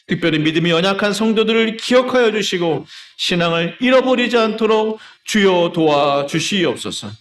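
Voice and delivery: building from steady to high, loud pleading; rising, insistent prayer cadence; fervent intercessory prayer. Real, not acted. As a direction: plead earnestly on the congregation's behalf. Leads intercessory prayer aloud.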